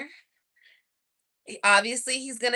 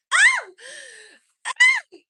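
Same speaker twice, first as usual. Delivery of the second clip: squealing oh; 10 dB louder than usual. Real, not acted. Reacting to his photo.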